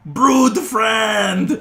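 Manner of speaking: very high, loud creature screech; excited lizard creature. Acted. Game master as lizard jumping on a friend, excited screech. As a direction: shriek a delighted, drawn-out greeting. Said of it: "jumps on Thanak and says".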